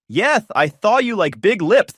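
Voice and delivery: slurred lisp, slow and loud, th for s; slurred fat-lipped lisp; lisping through swollen lips. Acted. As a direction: mush the s sounds into th like lips too big to move. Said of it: "she slurred ... trying to look seductive".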